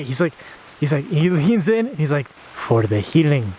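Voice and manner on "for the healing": low, slow and hushed, leaning in close; mysterious low murmur. Retold or imitated. Shaman leans in and tells Jake it's for the healing, slow low hush. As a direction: drop the voice, deliver it like a secret.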